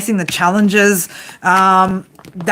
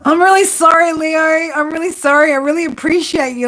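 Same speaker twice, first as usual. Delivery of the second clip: higher-pitched, put-on sorry voice; mock apology; 7 semitones higher than usual. Reader mimics the crying person apologising to Leo, mock pleading.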